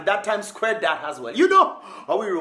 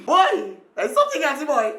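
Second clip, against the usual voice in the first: high, louder amazed outburst; surprised exclaim; 8 semitones higher, 5 dB louder than usual. Real, not acted. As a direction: react in shock to the shark. Reacting to shark video.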